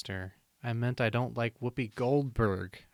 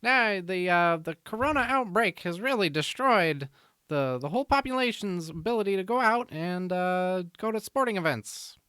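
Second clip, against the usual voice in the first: high, loud Mid-Atlantic newsreel voice; old-timey radio host reading the news; 1950s radio announcer; 9 semitones higher, 4 dB louder than usual. Pretend. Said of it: "nineteen fifties, uh, radio, uh, host".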